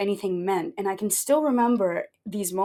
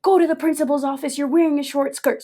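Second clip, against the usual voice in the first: loud, higher call of a name; stern teacher; 6 semitones higher, 4 dB louder than usual. Retold or imitated. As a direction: call her out like a strict teacher. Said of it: "She'd be like".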